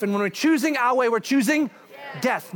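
loud, ringing pulpit delivery; preacher pressing life over death; preaching. Real, not acted. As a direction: drive the point home. Preaching climax.

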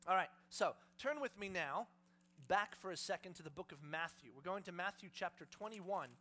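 ordinary talk

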